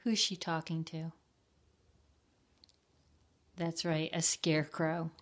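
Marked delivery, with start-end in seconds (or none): none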